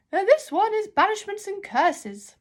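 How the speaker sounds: a grand, half-deaf librarian calling out. Acted. Deaf librarian shouts while holding her ear trumpet.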